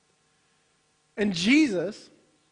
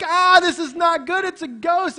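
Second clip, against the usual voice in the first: higher and louder, hammed-up fright; mock panic; 8 semitones higher, 5 dB louder than usual. Preacher voicing the disciples seeing a ghost, hammed-up fear. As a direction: play the scared disciple for laughs. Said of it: "They're like".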